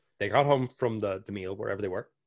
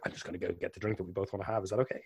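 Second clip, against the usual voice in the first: quick, soft aside; 8 dB quieter, much faster than usual. Retold or imitated. Host fetching drink.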